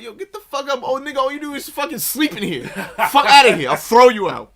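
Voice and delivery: high, hammed-up yelling through laughter; comic high-pitched rant; mock screaming match. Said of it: "wants to have a screaming match".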